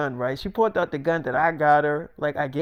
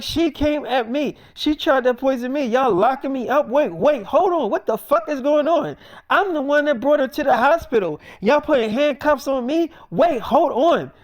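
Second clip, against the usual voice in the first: acted-out panic, much higher and louder; frantic indignant protest; 8 semitones higher, 4 dB louder than usual. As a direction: plead your case to the officers, pitch soaring. Acted protest while being handcuffed.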